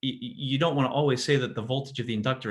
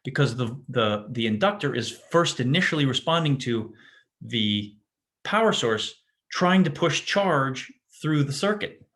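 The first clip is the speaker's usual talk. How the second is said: ordinary talk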